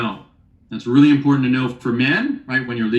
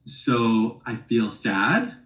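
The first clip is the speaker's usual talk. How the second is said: slow feeling words; 3 semitones lower, slower than usual. Shown as a demonstration. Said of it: "the third one is feelings".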